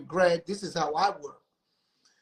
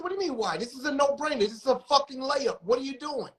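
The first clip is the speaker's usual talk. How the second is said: much higher, heated disbelief; retold exasperated rant; 9 semitones higher, faster than usual. Label head scolding executives on speakerphone, exasperated and high. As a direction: lay into them, it's a no-brainer.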